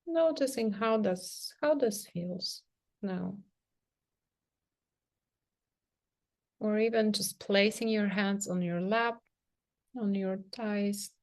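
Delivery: soft, unhurried meditation voice with long pauses; guided meditation. Real, not acted. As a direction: gently guide them into sensing the body, leaving space between phrases. Leads a guided self-touch meditation.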